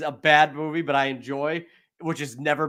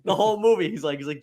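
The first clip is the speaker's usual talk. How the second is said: ordinary talk, nothing marked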